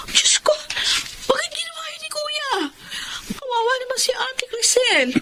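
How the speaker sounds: high, soft tearful cry of disbelief; crying in dismay. Acted. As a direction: sob it out, heartbroken for her sister-in-law. Sister learns brother is cheating.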